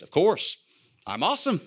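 a cheeky little self-congratulation; mock boastful self-praise. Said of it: "I'm preaching to myself".

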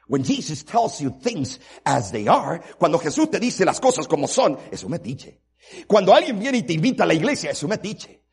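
loud, high preacher's cry; fiery sermon build; preaching at full force. Acted. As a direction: hammer the point home from the pulpit.

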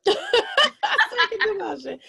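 laughing through the words. Real, not acted. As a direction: repeat it with a high laugh.

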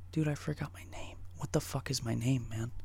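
hushed, bewildered whisper; imitated freaked-out whisper. Friend who forgot his name, imitated panicky whisper. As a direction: whisper it like a lost, tripping friend. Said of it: "starts freaking out".

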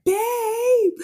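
high-pitched mock yell in Essex. Daughter yelling down the stairs to her dad, imitated yell. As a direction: call out like a little girl from upstairs. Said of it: "yelling down to him".

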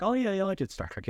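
high, offhand impression; casual dismissive quote. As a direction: toss it off like a bored actor. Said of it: "he was like".

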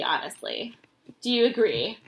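ordinary delivery

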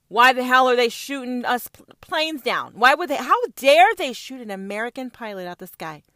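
loud, indignant mock outrage; mock outraged Americans. Mocking Americans furious about a downed plane.

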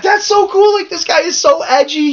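high-pitched gushing imitation of an excited fan; retold awed fan. Host recalls thinking a wrestler was cool, retold high-pitched gushing. Retelling own awed reaction.

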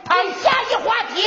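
bulldoze past the shock; forced hearty shout. Acted. Doctor forces subject change.

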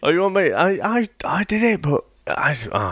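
usual speaking voice

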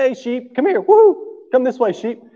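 high, coaxing call to the sheep; thieves calling sheep. Retold or imitated. Thief coaxing lost sheep, high calling voice. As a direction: lure the sheep like a thief, high and sing-song. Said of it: "the thieves would try to call them".

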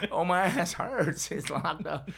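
slow, high, whining moan of pain; whiny complaint. Retold or imitated. Teller moans to the therapist about his locked-up backside, slow whine. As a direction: drag it out like a sore, pitiful patient.

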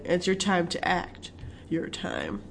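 soft commercial read; hushed promo voice; quiet ad voice. Retold or imitated.

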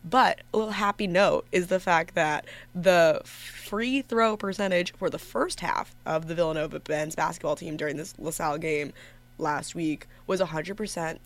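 ordinary talk

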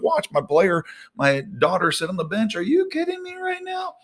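building from fretting to high exasperation; stressed adult rant. Retold or imitated. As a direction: panic over bills, rising to outrage.

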